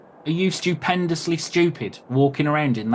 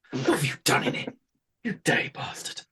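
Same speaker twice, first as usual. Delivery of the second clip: disgusted guard; 4 semitones higher, 6 dB quieter than usual. Acted. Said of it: "horrendous cheese and he's like".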